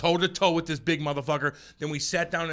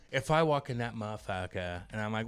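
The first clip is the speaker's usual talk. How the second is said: ordinary talk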